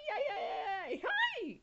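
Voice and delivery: high piercing cowboy yells, shouted; sharp high yells. A narrator's reading. Cowboy heading off stampeding cattle, high sharp yells. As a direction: yell hi hi to turn the cattle. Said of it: "yelled sharp, high yells".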